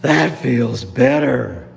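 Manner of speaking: loud, low, slow relief; relieved gators. Acted. Gators soothed by swamp water, low slow loud relief. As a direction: stretch it out with heavy satisfaction. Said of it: "those gators all said".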